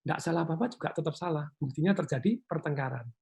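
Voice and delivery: ordinary talk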